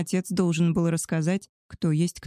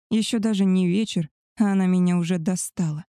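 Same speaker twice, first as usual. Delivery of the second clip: usual speaking voice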